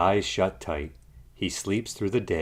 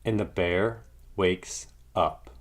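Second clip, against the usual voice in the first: ordinary delivery, nothing marked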